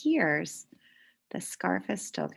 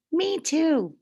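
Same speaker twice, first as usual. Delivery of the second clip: put-on higher eager little kid voice; child's voice; 10 semitones higher, 5 dB louder than usual. Children in class agreeing with Emma, higher and eager.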